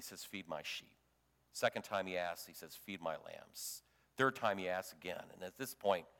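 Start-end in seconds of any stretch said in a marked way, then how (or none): 0.2-0.9 much lower and much softer, gently; a quiet, loving commission; tender charge. Acted. Jesus gently commissions Peter. 2.8-3.8 much lower and much softer, gently; a quiet, loving commission; tender charge. Acted. Jesus gently commissions Peter.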